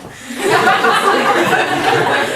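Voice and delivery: laughing hard at the absurdity; high burst of laughter. Real, not acted. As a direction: let out a big, high, amused laugh. Laughing after mocking quote.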